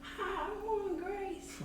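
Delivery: very high, soft put-on voice; imitating his high voice. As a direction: mimic the man's odd high-pitched way of talking. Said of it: "he talked like this".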